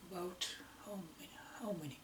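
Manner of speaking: very soft, quick self-questioning; thinking aloud. Real, not acted. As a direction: murmur it quickly while searching your memory. Searching memory for the number.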